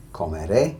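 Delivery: comeré with emphasis on ré; comeré, rising onto a strong ré; final-syllable stress. Shown as a demonstration. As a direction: punch the last syllable of comeré. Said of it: "stressing that very last syllable".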